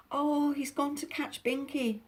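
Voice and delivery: put-on high, frightened girl's cry; alarmed cry. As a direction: gasp it out in alarm. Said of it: "cried Molly".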